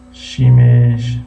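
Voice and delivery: شیمش stretched very slowly, both vowels held; drawn-out reading. Real, not acted. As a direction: sound out شیمش very slowly as "shiii-meeesh". Puzzles slowly over viewer's word. («شیمش» کشیده و خیلی آهسته)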